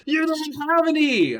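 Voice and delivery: very high, loud manic yell; yelled madly. A narrator's reading. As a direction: shriek it in a frenzy. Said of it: "I yelled in madly".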